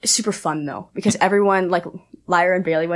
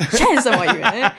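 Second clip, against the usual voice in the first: laughing eager yes; 4 semitones higher than usual. Retold or imitated.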